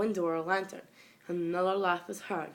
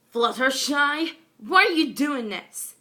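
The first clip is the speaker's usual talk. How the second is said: loud, higher, slow demanding roar; anguished roar; 8 semitones higher, 9 dB louder than usual. A narrator's reading. Pegasus roaring at corrupted Fluttershy, slow and loud. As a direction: roar the question at her. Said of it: "Fireblast roared".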